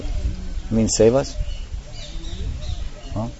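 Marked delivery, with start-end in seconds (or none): none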